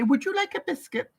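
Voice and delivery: put-on polite child. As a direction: play her small child offering a biscuit, sweetly. Mother voices her polite child.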